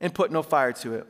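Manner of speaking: measured, emphatic public Bible reading; Scripture reading. A narrator's reading. Pastor reading Elijah's showdown to his church, emphatic Scripture reading. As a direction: read it out formally, phrase by phrase.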